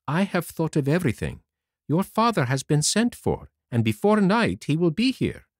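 a hint of a kind fairy sister; gentle fairy voice. A narrator's reading. The fairy answers Pinocchio in a lightly voiced fairy tone.

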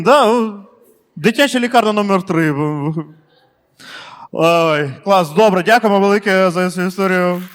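ordinary talk

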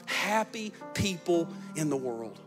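ordinary talk

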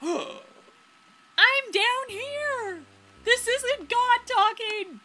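very high, loud, slow call; drawn-out, carrying acted yell; shouting up. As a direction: holler up from the water to the boat. Character shouts up from water.

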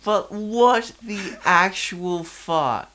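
high, slow, loud incredulous outburst; disbelief. Real, not acted. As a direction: drag it out, still baffled. Repeating his baffled reaction.

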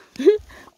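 bright burst of laughter up high and loud; short high giggle. Real, not acted. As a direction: laugh out at her own joke. Laughs after joke about bare forests.

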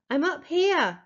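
shouting back. Acted. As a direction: call down to grandmother from high up. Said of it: "I shouted back".

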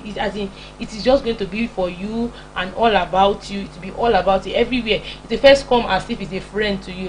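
ordinary delivery

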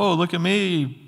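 high, showy voice of a proud person; mock prideful. Preacher mocking a prideful boaster, hammed-up and high. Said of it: "I don't say this to be prideful".

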